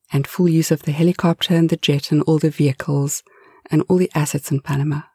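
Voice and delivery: usual speaking voice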